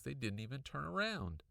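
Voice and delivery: ordinary delivery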